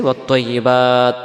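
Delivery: ওয়াত্তাইয়িবাত chanted to a stop on "baat"; breath-stop ending. Shown as a demonstration. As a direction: hold the last vowel of ওয়াত্তাইয়িবাত. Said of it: "যদি আমরা এখানে দমটা ছেড়ে দিই".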